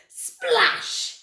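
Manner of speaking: high, explosive drawn-out word; big splash. A narrator's reading. Storyteller as tyrannosaurs hit water, big high splash.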